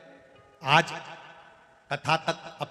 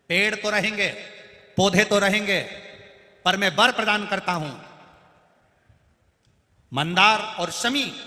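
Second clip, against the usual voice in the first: raised, louder divine proclamation; Lord Ganesh granting a boon; 5 semitones higher, 7 dB louder than usual. Acted. Ganesh declares his boon to the trees, in a raised, loud voice. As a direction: pronounce the blessing like a god. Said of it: "Ganesh जी ने कहा".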